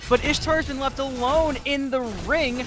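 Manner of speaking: fighting game announcer. Real, not acted. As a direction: build the call to a peak. Live play-by-play hype builds.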